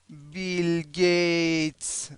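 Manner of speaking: "بیل گیتس" stretched like the German phrase; very slow model. Shown as a demonstration. Teacher matches Bill Gates to the German phrase, "بیل گیتس" very slow. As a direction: draw out each syllable of "بیل گیتس".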